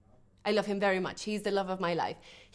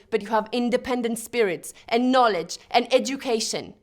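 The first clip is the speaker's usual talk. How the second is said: emphatic, forceful call to persevere; rousing exhortation; 3 semitones higher, 5 dB louder than usual. Acted. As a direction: urge them on like a stage oration.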